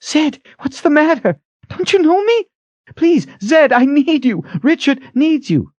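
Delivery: high, frantic pleading. A narrator's reading. Kalen begging Zed to know her, frantic. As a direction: beg desperately, pitched far up. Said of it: "Kalen was frantic".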